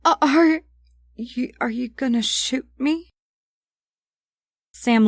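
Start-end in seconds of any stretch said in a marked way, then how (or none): 0.1-3.1 high, cracking scared question. A narrator's reading. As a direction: ask with a breaking voice. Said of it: "Abigail's voice cracked".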